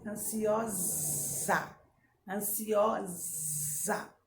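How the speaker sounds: "Ansiosa" said slowly, the s buzzed as a long zzz; slow "Ansiosa" with a bee-like z; buzzing Z sound. Shown as a demonstration. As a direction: draw out the s in "Ansiosa" as a voiced buzz. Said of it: "Da abelha".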